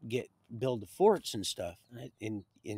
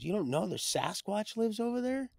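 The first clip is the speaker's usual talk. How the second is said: pitched much higher in mock amazement; incredulous reveal; 11 semitones higher than usual. Neighbor telling Roy about Sasquatch, lightly mock amazed. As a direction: imitate him spilling the secret.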